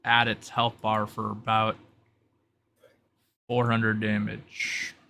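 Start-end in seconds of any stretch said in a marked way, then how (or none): none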